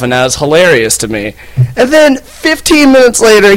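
ordinary talk, nothing marked

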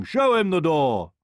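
commanding king's shout; calling out. A narrator's reading. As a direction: order the guards about. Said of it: "called the king".

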